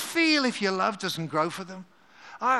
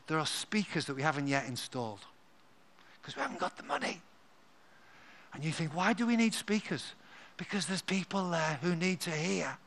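much softer, lower, like sharing a secret; mock secret told to the congregation; confiding hush; 5 semitones lower, 10 dB quieter than usual. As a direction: lean in and keep it between us. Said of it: "don't tell anyone else".

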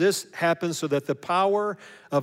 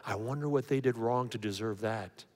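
hushed, much softer judging aside; secret whisper; 6 semitones lower, 10 dB quieter than usual. Retold or imitated. Gossiping about a suffering person, quietly. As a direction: murmur it low behind someone's back. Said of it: "or we whisper to someone".

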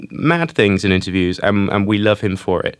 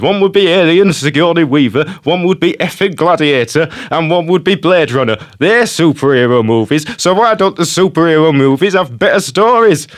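laughing, higher mock Yorkshire voice; Ridley impression; 5 semitones higher, 3 dB louder than usual. Host reads Ridley's superhero rant to a co-host, in mock Yorkshire voice. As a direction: ham up the grumpy old director with a laugh.

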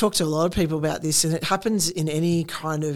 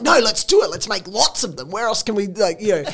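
eager husband; 5 semitones higher, 6 dB louder than usual. Retold or imitated. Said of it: "And he'll be like".

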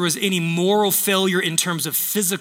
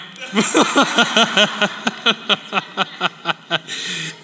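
long rapid chuckle, trailing off; laughing. Real, not acted. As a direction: laugh it out till it fades. Laughing after his joke.

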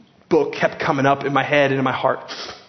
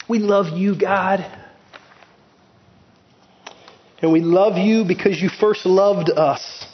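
prayer; slower than usual. Acted. Prays over the congregation.

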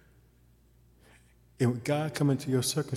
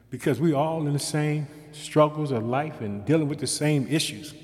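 ordinary talk

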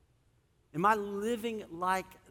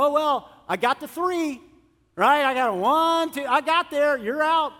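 high, loud three-strikes call; mock strict umpire; 7 semitones higher, 9 dB louder, slower than usual. Preacher imagining a God who counts strikes, loud umpire call. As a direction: bark it out like an umpire calling you out.

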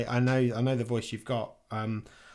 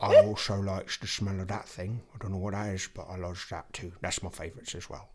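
low, quiet talking-dog voice; dog's soft inner monologue, pitched down; Peaches; 5 semitones lower, 4 dB quieter than usual. Acted. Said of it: "doing Peach's voice".

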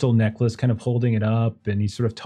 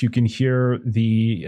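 ordinary talk

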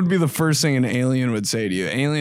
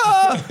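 mock yell; 4 dB louder than usual. Mock yell killing alien.

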